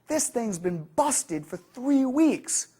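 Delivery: high, slow, heated complaint; intense frustration. Shown as a demonstration. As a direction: play the fed-up member, pushing hard. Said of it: "the member's intensity".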